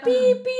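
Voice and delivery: high, loud referee-whistle beeps; imitated final whistle. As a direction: mimic the whistle with high peeps. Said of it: "terminó el partido". (silbato final imitado, agudo)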